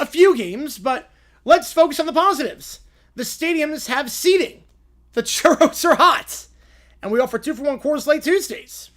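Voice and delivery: high, loud, nervous pleading lawyer; a panicky lawyer who bluffs, pushed up high; flustered Lionel Hutz. Acted. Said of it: "Mister Hutz, your rebuttal?".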